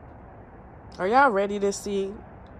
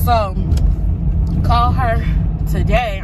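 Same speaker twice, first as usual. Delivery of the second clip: out of breath; 2 semitones higher, 6 dB louder, slower than usual. Real, not acted. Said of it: "I'm sorry, I'm out of breath".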